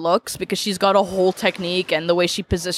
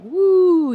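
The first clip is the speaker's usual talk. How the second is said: spacey woo; 12 semitones higher, 4 dB louder than usual. Retold or imitated. Describing spacey eyes.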